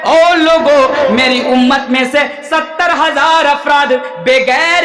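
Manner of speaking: ringing, drawn-out sermon proclamation; impassioned preaching. Real, not acted. Preacher calling men and women to hear the Prophet, impassioned proclamation. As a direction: call out the hadith with long held words. Proclaims hadith at full force.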